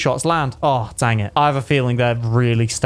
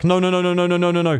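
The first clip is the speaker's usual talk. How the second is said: higher, hurried string of protests; rapid-fire denial; 4 semitones higher than usual. Real, not acted. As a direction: rattle off the denial without a break. Denies his voice cracked.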